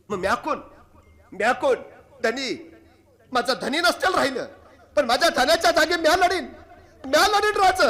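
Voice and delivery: defiant war vow. Acted. Widow vowing to the king to fight, loud defiant battle cry. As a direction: swear to fight in her husband's place. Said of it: "शिवाकाशीची बायको म्हणाली".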